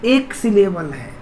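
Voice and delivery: ordinary talk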